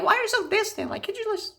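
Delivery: very high whiny mock voice; mocking girlfriend. Guy mimics date asking why he's distant, high mocking. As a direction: squeak it like a whiny girl. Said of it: "she was like".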